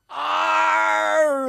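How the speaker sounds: high, loud long cartoon scream falling away; comic-book cliff-fall yell. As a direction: scream as you tumble off a cliff. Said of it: "there's me falling off a cliff".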